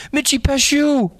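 high terrified cry; panicked cry. A narrator's reading. Boy naming the monster as he flees, high terrified cry. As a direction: scream the monster's name in panic. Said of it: "the first boy cried".

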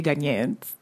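Ghanaians said the Ghanaian way, gan-YAH-ens; lightly voiced Ghanaian accent on Ghanaians; Ghanaian pronunciation. Shown as a demonstration. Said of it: "we say it with a funny accent".

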